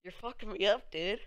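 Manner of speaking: loud, high, annoyed but amused; frustrated outburst. Real, not acted. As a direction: blurt it out at being thrown off. Tripped up by a chat comment.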